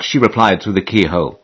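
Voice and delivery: plain reading voice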